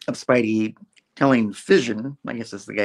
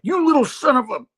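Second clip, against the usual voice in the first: much higher, louder mock insult; mock anger; 6 dB louder than usual. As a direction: fake outrage, cut off. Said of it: "Spidey's like".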